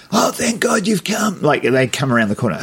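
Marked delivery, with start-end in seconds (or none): 0.1-1.4 high, slow desperate plea; pleading victim. Retold or imitated. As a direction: beg like a trapped victim. Wheelchair victim acting scared.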